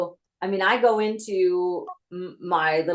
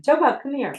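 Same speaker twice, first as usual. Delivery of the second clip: higher-pitched call to come over; 7 semitones higher than usual. Real, not acted. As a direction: call Joe Bob over from across the way. Calls over someone crying nearby.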